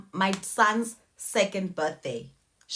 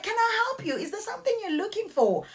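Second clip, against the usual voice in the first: lightly voiced polite stranger, higher; polite helpful lady; 11 semitones higher, faster than usual. New house owner offering help at the door, lightly voiced high politeness.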